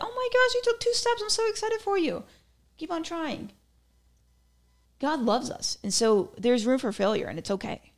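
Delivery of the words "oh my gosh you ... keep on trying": put-on much higher, sing-song baby-talk delight; gushing proud parent. Loving parent cheering a baby's two steps, high gushing coo. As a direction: coo over the baby like its first steps are a miracle. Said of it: "No, they'd be like".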